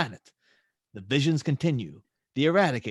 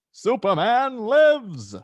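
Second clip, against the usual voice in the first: much louder, higher and slow; triumphant cry; 8 semitones higher, 7 dB louder, much slower than usual. Acted. Superman returns to Metropolis, shouting in triumph. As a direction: proclaim it to the whole city.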